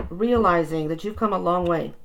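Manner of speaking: normal talking voice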